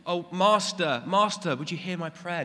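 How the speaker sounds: lightly mocking lofty kneeling prayer; mock grand prayer. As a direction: overdo the formal plea to God. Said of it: "Do we get down on our knees and say".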